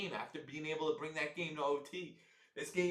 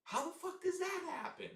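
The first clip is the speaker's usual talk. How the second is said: much higher, incredulous yelp of a question; can't believe what he just saw; outraged disbelief. Real, not acted. Fan reacting to his punter's shank, in high outraged disbelief. Fan watching a botched punt.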